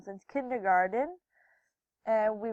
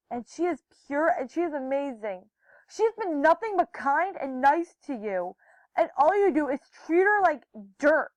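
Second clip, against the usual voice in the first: imitated angry yelling; 4 semitones higher, 5 dB louder than usual. Hannah scolding Jacob on her friend's behalf, acted yelling. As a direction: imitate a friend telling someone off. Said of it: "she started yelling".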